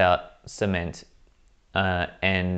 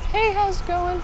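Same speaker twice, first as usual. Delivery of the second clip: street fundraiser. Retold or imitated. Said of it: "someone goes".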